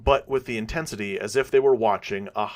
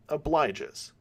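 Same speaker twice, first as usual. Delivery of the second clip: ordinary delivery, nothing marked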